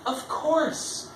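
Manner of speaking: high, drawn-out oh of discovery; student's sudden realisation. Retold or imitated. Speaker retells a student solving the myth, drawn-out realisation. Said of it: "this student was like".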